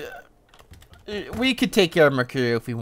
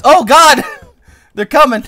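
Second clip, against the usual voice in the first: much higher, louder alarm; alarmed laughing; 12 semitones higher, 13 dB louder than usual. Real, not acted. Gamer seeing enemies charge, alarmed and laughing. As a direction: panic laughing as enemies come.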